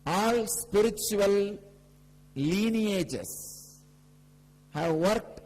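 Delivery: slow, high proclamation, each phrase drawn out; emphatic preaching. Real, not acted. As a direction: proclaim it phrase by phrase, stretching the key words. Preaches on mystical traditions.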